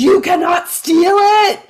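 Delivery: high, loud, drawn-out fan's yell; top-of-the-lungs yell, breaking into laughter at the end; re-enacted scream. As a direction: scream it slowly, like protesting from the stands. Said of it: "screaming at the top of my lungs".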